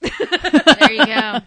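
drawn out through real laughter; laughing. Real, not acted. Friend cracking up at the joke, loud laughing.

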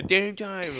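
put-on high childish chant; kid voice. Shown as a demonstration. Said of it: "Like the twelve-year-old kids".

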